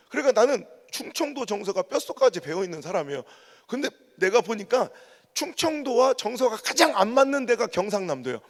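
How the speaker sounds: normal talking voice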